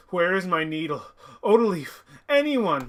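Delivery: rising, acted plea for help; anxious shout for anyone nearby; urgent calling out. Character calls out for help.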